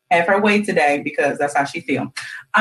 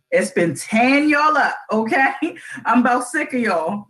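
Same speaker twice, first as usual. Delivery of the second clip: ordinary delivery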